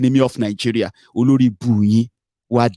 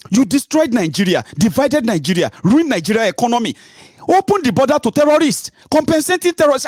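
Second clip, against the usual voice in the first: pitched way up, ranting in anger; angry tirade; 10 semitones higher than usual. Real, not acted. Ranting at those who ruined Nigeria, angry. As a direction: hurl each charge higher and angrier.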